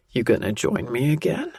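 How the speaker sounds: a gruff man turning playful; teasing, rough male voice. A narrator's reading. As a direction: tease her in a gravelly man's voice. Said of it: "The teasing shift in such a rough voice".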